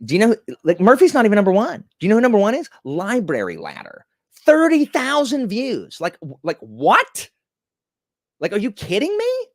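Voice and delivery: worked-up rant. Real, not acted. Streamer to chat, worked up over view counts, excited shouting. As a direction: get all fired up over the numbers. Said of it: "Sorry, I got worked up about that one".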